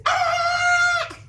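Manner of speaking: high, loud panicked shriek; panicked scream. Shown as a demonstration. Teacher shows Magnolia's panic to kids, loud shriek. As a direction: shriek in panic like the seagulls attack. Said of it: "say how Magnolia is feeling".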